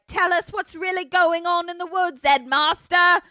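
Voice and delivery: high, loud protester demand; hammed-up heckling; mock protest shout. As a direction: shout it up at the headmaster like an angry crowd. Voicing protesters confronting the headmaster.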